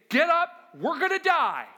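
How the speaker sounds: loud, high panicked cry of the disciples; panicked yell. Acted. Disciples shouting to wake sleeping Jesus in panic. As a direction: shout it like men sure the boat is sinking.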